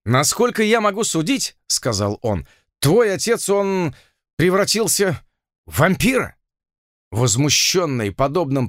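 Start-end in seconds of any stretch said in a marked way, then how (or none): 0.0-6.4 frightened. A narrator's reading. Hacktorp frightened report.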